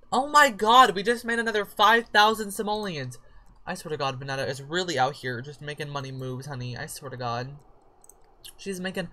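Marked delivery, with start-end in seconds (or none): 0.1-3.2 high, loud thrilled exclamation; excited. Real, not acted. Player thrilled at her sim's money, excited. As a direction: celebrate the windfall.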